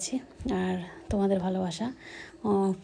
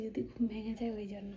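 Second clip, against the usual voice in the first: much softer, hushed whisper; 13 dB quieter than usual. Real, not acted. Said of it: "আস্তে আস্তে কথা বলছি".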